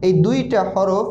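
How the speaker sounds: usual speaking voice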